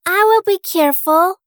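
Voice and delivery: put-on high, bright girl voice; little girl voice. A narrator's reading.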